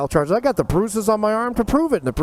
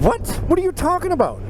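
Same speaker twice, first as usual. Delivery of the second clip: shocked disbelief; 12 semitones higher than usual. Retold or imitated. Accused by cop, shocked denial.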